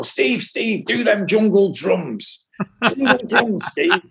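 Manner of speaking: rally the band like an excited frontman. Retold or imitated. Ian directing the band.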